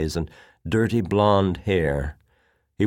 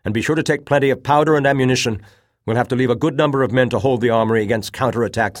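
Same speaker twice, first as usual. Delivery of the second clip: fast, loud, higher-pitched commands; urgent orders; 6 semitones higher, 6 dB louder, faster than usual. A narrator's reading. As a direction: rattle off orders under pressure. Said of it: "he ordered".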